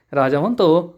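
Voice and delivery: ordinary delivery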